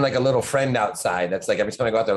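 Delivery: ordinary talk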